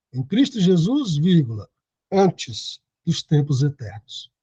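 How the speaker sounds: ordinary talk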